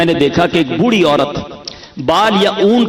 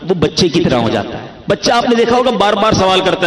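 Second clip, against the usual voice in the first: ordinary delivery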